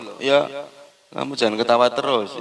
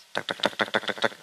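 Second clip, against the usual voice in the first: knocking sound; 6 dB quieter, faster than usual. Retold or imitated. Knocking on the door.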